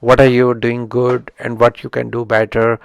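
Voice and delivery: usual speaking voice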